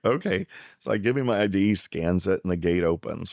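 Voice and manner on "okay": a high, caught-off-guard "okay"; startled okay. Retold or imitated. Narrator yields to the gate guard, high and startled. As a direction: squeak it out, taken aback. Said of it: "And I was like".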